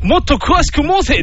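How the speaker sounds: high, slow lordly command; mock court noble. A skater rehearsing as a court noble, mock lordly. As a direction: give the order like a pompous lord. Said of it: "っていう練習をずっとしてたのに".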